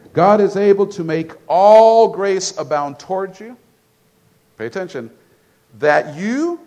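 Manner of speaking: loud, slow scripture proclamation; booming pulpit reading; preaching. Acted. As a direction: proclaim the verse to the whole church. Preaching scripture at full force.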